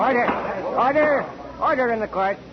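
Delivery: a judge raising his voice over the uproar; calling for order. Acted. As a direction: shout the room back into silence. Judge silences courtroom uproar.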